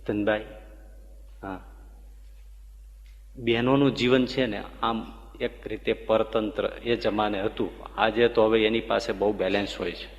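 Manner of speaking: soft, gentle grandmother speaking to a devotee; tender elder's voice. Retold or imitated. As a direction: speak kindly, like a loving old mother. Surajba speaks kindly to Dhanbai.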